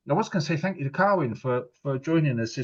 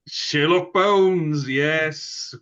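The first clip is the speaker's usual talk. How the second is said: drawn-out triumphant; 6 semitones higher, 6 dB louder, much slower than usual. Real, not acted. Triumphant punchline confirmation.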